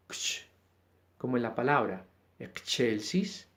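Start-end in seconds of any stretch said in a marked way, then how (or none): 0.1-0.4 a short soft ksh; demo consonant sound. Shown as a demonstration. Teacher isolating the XC sound, soft. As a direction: breathe ksh quietly. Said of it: "su sonido es ksh".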